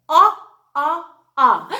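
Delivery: "ah" loud, as the short o in ostrich, three times; short o sound. Shown as a demonstration. Teacher sounding out the letter O to the class, loud ah. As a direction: punch each "ah" out loud. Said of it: "O says".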